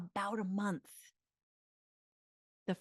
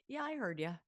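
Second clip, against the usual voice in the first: light brushing-off; 8 semitones higher than usual. Shown as a demonstration. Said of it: "Instead, it's like".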